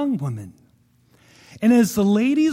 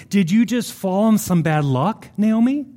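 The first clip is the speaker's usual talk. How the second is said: ordinary talk, nothing marked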